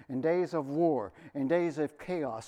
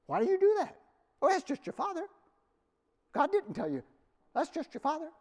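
much higher, scoffing put-on voice; mocking critics; 13 semitones higher than usual. Scoffers jeering at the Rechabites, in a high mocking tone. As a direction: sneer it like a scornful neighbour.

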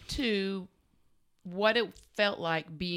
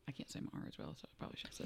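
half-voiced whisper to herself; whispered aside; 17 dB quieter, much faster than usual. Real, not acted. Aside about her R.

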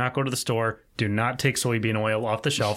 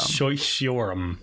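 slow halting sho-, shiorum; staccato; 2 semitones lower, slower than usual. Shown as a demonstration. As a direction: sound out shiorum slowly. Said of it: "give me syllable by syllable".

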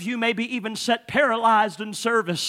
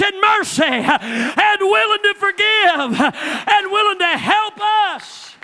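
full-force preaching; 5 semitones higher than usual. Acted. Preacher promising forgiveness to everyone, full-force preaching. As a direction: build to the peak and hold it.

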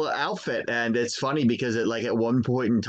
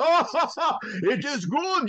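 put-on chuckling in a German accent; German dad laughing at his frightened kid; slower than usual. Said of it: "he starts laughing in this deep German".

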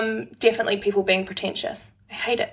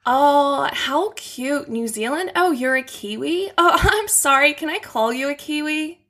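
put-on higher-pitched Valley Girl American accent; 4 semitones higher than usual. Shown as a demonstration. Guest playing an American meeting a Kiwi, Valley Girl accent. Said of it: "this is the Valley Girl version".

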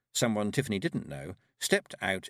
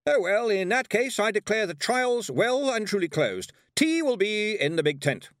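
high, loud fluting proclamation; over-bright mistress of ceremonies; announcing hostess; 12 semitones higher, 6 dB louder than usual. A narrator's reading. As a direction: close the event with giddy ceremony. Relieved hostess closes the trials loudly.

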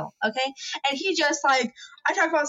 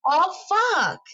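dismayed oh fuck; 7 semitones higher than usual. Retold or imitated. Realizing wrong Chipotle location.

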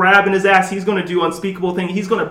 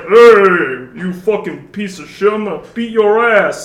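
bad Russian accent; 5 dB louder than usual. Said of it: "that was a shitty-ass Russian accent".

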